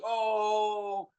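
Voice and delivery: long, high drawn-out "oh"; held high crowd jeer, imitated; crowd's "oh". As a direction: imitate a crowd reacting to a fight. Imitating the crowd's reaction to the fight.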